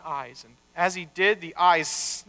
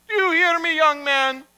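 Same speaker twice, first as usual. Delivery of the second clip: higher-pitched scolding question; stern father; 8 semitones higher, 3 dB louder than usual. Acted. Rabbi checking his cheeky marionette, sternly scolding. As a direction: demand an answer, pitched up.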